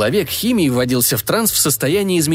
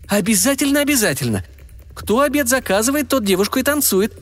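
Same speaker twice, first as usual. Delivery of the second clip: высокий напористый женский голос; настаивать высоко и весело; голос Зины; 9 semitones higher than usual. A narrator's reading. Зина настаивает. (голос Зины)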